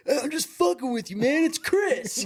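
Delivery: high, far-off friend's voice, teasing; prankster reveal. Retold or imitated. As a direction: toss it off like the caller who got you. Prankster reveals himself laughing.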